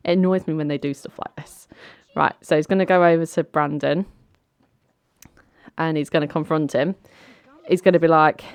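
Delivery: usual speaking voice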